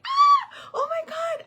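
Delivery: thrilled high-pitched squeal; excited shriek. Retold or imitated. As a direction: shriek with delight at baby rabbits. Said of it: "I was excited".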